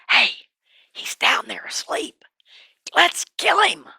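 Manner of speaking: hushed whisper, then eager; whispering captain. Acted. Captain whispering to David about killing Saul. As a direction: urge the kill in a whisper. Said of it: "he says".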